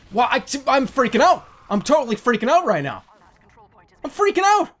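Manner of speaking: high-pitched rising panic; freaking out. Real, not acted. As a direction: build to a high, frantic cry. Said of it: "I am totally freaking out".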